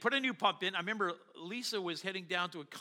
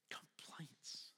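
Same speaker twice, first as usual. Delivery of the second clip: breathy one-word whisper, much softer; whispered aside; 17 dB quieter than usual. Real, not acted. Muttered aside on complaints.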